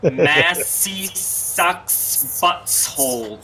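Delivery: slow hissing snake voice; drawn-out s like a snake. Pretend. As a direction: stretch every s into a hiss. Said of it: "God, you sound like a snake".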